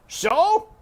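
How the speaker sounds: loud dismissive shout; loud surly yell; Uncle shouting back. Acted. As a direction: throw it back at her. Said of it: "shouted Uncle back".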